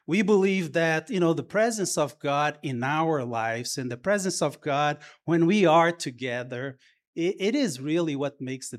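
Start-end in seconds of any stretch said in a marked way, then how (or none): none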